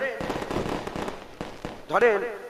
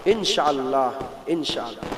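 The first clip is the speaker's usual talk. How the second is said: leading response; 9 semitones lower than usual. Shown as a demonstration. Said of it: "বলেন".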